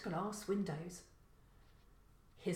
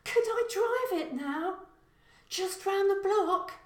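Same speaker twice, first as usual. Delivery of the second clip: put-on 1 pleading young son; 12 semitones higher, 7 dB louder than usual. Son begs to drive Jaguar.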